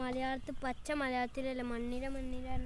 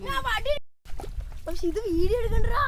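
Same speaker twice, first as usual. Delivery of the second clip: high, very loud shout; loud protest; 8 semitones higher, 12 dB louder than usual. Real, not acted. Boy telling friend not to film, shouting in protest. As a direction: shout it out, pitch jumping up.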